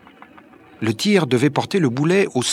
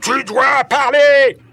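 slow, very high, loud command; shouting; 13 semitones higher, 8 dB louder, slower than usual. A narrator's reading. Barbicane threatens to strike, shouting an order. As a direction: bellow it, stretching each word. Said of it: "crie Barbicane".

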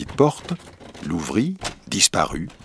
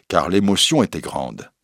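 ordinary delivery

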